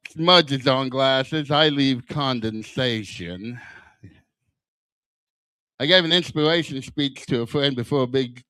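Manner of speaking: usual speaking voice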